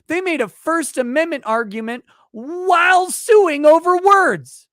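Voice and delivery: builds to a shouted, stretched over words; furious rant. Real, not acted. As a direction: rage rising to a yell. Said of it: "What the fuck".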